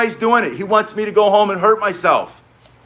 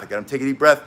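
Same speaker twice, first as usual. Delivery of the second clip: usual speaking voice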